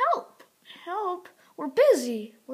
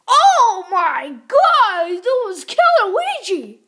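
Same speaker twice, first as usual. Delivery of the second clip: loud, drawn-out cry of horror; alarmed shouting; 4 semitones higher, 13 dB louder, slower than usual. Pretend. Mario tells friends Luigi was nearly killed, alarmed shouting. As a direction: yell it in play-acted horror, stretching the words.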